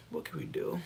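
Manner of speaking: voiceless resigned whisper. Real, not acted. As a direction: breathe it out. Whispered repeat.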